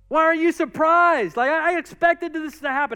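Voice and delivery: high, loud mock-puzzled question; acted incredulity. Daniel asking the king why he is surprised, playful incredulity. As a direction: toss it back as if it were obvious.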